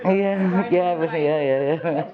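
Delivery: laughing yeah yeah; giggling agreement. Real, not acted. Laughing with Ange in the audience.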